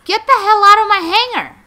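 high, very loud furious yell; angry order. Pretend. Owner chasing someone out of the hangar, angry shouted order. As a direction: scream at an intruder to leave.

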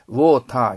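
ウォーター with an open Japanese 'taa' ending; Japanese katakana ending. Shown as a demonstration. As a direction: say ウォーター loudly in katakana style, no R. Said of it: "ウォーターじゃなくて".

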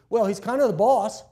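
put-on a kid piping up with his answer; child's answer.

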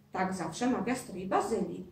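ordinary delivery

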